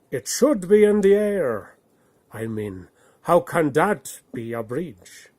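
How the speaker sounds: the bear questioning, unconvinced; doubtful bear. Acted. Bear objecting to Odd's bridge, loudly doubtful. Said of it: "said the bear doubtfully".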